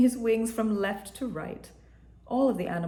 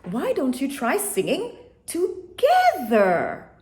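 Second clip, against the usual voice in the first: sing-song suggestion; 6 semitones higher, 4 dB louder, slower than usual. A narrator's reading. Buffalo gently suggesting.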